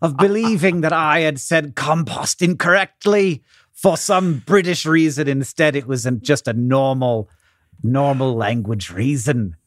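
mock-British speech, sinking in pitch; put-on British accent. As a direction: ham up a British accent, ending low. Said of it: "it happened again".

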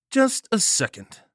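high-pitched irritated snap; snarled. A narrator's reading. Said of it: "Nagel snarled".

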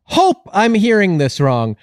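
high-pitched mock outrage; indignant. Podcaster confronting Beth over unmentioned tournament, high mock indignation.

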